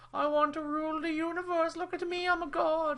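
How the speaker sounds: high, mocking cartoon villain voice; mock villain gloating with a fake laugh; villain parody. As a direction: ham up a megalomaniac god. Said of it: "he's just".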